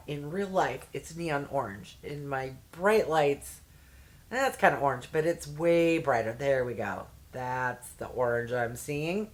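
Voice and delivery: ordinary talk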